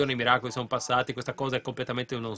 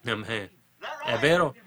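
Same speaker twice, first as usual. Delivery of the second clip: ordinary talk, nothing marked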